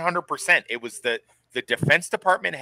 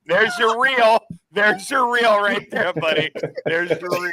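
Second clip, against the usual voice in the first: higher, drawn-out crowing; 7 semitones higher than usual. Acted. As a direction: call it out high to a buddy. Mock congratulating on clip material.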